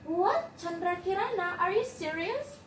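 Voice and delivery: high, rising questions in disbelief; astonished. Acted. Grandma hearing the girl is Chandra Kirana, astonished. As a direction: pitch it up in amazement.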